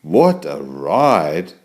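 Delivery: usual speaking voice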